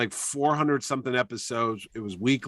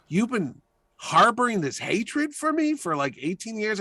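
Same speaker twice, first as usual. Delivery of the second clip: noticeably higher, stunned disbelief; incredulous question; 9 semitones higher than usual. Retold or imitated. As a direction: ask it like you can't believe what you just heard. Chaykin asks in disbelief.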